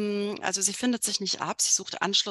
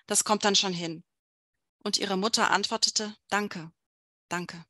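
measured novel narration with clear pauses; literary story reading; 4 dB louder than usual. A narrator's reading. Author reads a novel passage to an event audience, measured literary narration. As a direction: read it out phrase by phrase.

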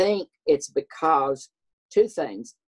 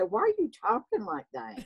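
high, softer quoted question; puzzled mother's higher, quieter voice; 8 semitones higher, 4 dB quieter than usual. Retold or imitated. Mother questioning her son on the phone, high imitation. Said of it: "then I'd call Mother".

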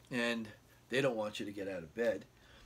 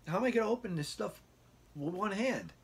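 much higher, fast complaint; exasperated; 9 semitones higher than usual. Retold or imitated. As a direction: whine it in disbelief. Exasperated at unopened hospital food.

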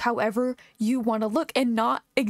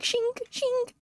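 scythe noise. Pretend. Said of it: "greet everyone like the Grim Reaper".

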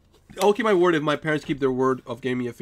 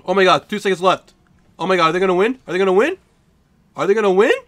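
excited; 8 dB louder, faster than usual. Real, not acted. Tense final seconds of match.

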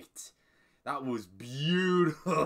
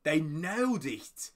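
ordinary talk, nothing marked

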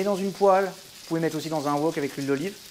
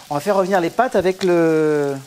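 put-on southern French accent. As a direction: ham up a southern French accent like a Marseille cook. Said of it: "je vais prendre mon accent du Sud".

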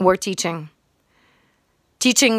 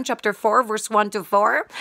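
normal talking voice

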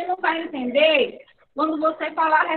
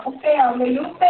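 ordinary talk, nothing marked

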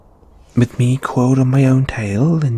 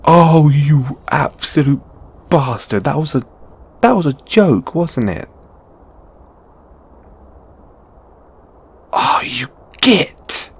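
higher, emphatic mock scolding; playful indignation; 5 semitones higher, 4 dB louder than usual. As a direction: sputter insults at a teasing friend. Indignant at being pranked.

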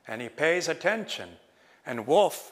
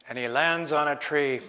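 plain reading voice

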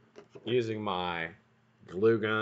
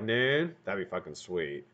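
usual speaking voice

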